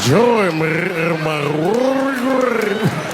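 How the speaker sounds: loud, slow, drawn-out throat-rolling wail; high, long gargled howl; rolled throat. Shown as a demonstration. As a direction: roll it in the throat, stretching every word. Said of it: "you gotta roll your throat".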